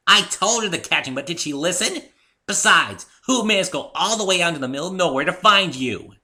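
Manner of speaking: loud, high and indignant; fighting back at an old friend; heated argument. Acted. Heated quarrel between friends.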